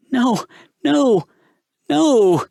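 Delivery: high panicked no; begging in terror; terrified denial. A narrator's reading.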